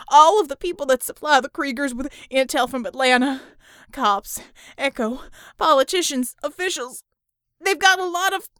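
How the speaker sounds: hurt man building to a frantic yell; pained confession. Acted. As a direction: gasp and shout it out through pain. Tortured captive confesses in pain.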